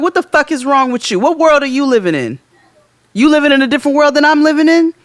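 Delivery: high, loud acted outrage; retold incredulous tirade; indignant scolding. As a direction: berate him in rising disbelief.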